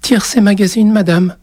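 put-on very high child's voice; meek, high-pitched child; scared little boy. A narrator's reading. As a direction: answer politely as a timid small boy. Scared boy answers the witch.

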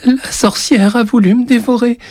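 put-on very high, panicked child's wail; squeaky, near-tears boy; tearful little boy. A narrator's reading. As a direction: blurt it out as a terrified small child. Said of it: "les larmes aux yeux".

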